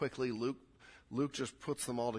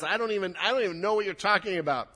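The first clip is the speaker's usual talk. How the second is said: raised, rushed denial; emphatic denial; 5 semitones higher, 6 dB louder, faster than usual. Acted. Peter at his third denial, emphatic. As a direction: deny it fast and forcefully. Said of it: "Peter's denial is more emphatic".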